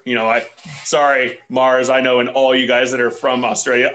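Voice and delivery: ordinary talk, nothing marked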